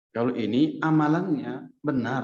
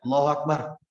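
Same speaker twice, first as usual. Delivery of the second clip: louder prayer call; 2 semitones higher, 3 dB louder than usual. Shown as a demonstration.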